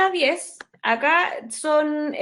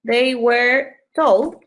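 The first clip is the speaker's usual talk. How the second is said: very slow, word by word; slow dictation; 4 dB louder, much slower than usual. Shown as a demonstration. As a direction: spell it out slowly for the class. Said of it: "¿Sí? Em". (dictado muy lento)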